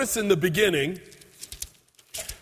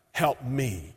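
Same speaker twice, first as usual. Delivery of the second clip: low quiet plea; 7 semitones lower, 7 dB quieter than usual. Acted. Third plea, dropped low.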